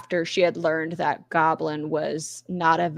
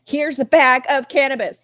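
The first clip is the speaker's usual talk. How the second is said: mock yell; 6 semitones higher, 5 dB louder than usual. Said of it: "Why'd she yell that at us?".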